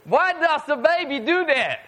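usual speaking voice

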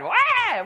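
high-pitched mock baby wail; imitated hard baby cry. Preacher showing how hard a baby cries, high mock wail. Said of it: "Why does he cry hard, really hard".

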